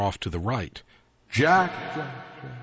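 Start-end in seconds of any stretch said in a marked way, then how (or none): 1.3-2.5 a hint of an eerie ghost calling; lightly hissed spectral call; ghostly hiss. A narrator's reading. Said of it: "it hissed".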